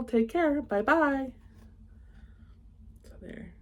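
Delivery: usual speaking voice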